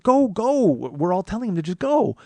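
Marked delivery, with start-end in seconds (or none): 0.0-0.6 raised-pitch insistent push; acted crowd encouragement; urging him on. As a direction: urge someone along impatiently.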